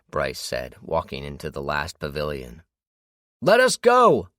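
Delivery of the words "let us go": high, loud desperate yell. A narrator's reading. James yells at Bryce vanishing into the pavilion, loud yell. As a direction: yell after him as he disappears. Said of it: "James yelled".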